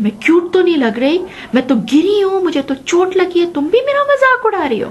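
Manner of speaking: put-on high, fast, rising hurt child's complaint; upset child. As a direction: protest like a hurt little girl. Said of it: "मैंने कहा". (गिरकर चोट लगी बच्ची की ऊँची, तेज़ शिकायत)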